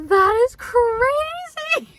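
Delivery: high, loud, drawn-out exclamation; amazed disbelief. Retold or imitated. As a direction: stretch it out in mock astonishment. The men's amazed reaction, acted.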